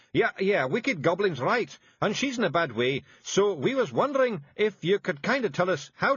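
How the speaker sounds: high, thick Scots pixie voice; small tough Scots fairy breaking bad news; Scottish Feegle voice. A narrator's reading. Feegles fibbing to witch.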